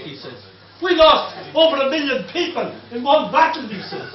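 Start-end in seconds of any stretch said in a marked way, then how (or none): 0.8-3.7 loud and scornful; retold shout. German heckler boasts of war losses, loud. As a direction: throw it at him loudly. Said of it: "the German shouted up to me".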